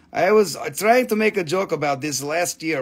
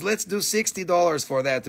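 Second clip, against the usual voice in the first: ordinary delivery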